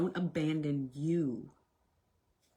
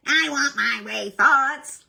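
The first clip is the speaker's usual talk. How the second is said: a hint of a demanding child, higher and louder; whiny inner child; 8 semitones higher, 11 dB louder, slower than usual. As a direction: let the little kid whine. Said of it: "When the inner child tries to inject".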